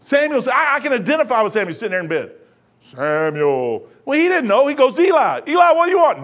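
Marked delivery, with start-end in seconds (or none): 2.8-3.9 low, drawn-out call of a name; voice of God calling. Acted. Voices God calling Samuel in bed. 5.5-6.2 put-on high, loud call to the old priest; boy answering eagerly. Acts Samuel running to Eli.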